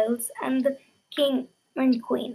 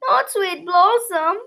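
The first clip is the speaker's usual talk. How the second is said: sobbing; 7 semitones higher, slower than usual. Acted. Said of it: "Another sobbed".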